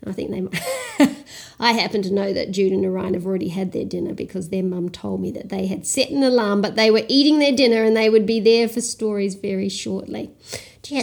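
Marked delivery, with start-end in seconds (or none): none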